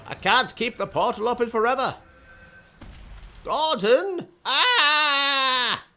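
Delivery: high, loud panicked cry rising to a scream; panicked scientist. Acted. Scientist crying to Gordon as the portal fails, panicked. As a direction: call out desperately in a high voice, then scream.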